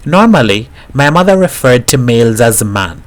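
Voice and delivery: ordinary delivery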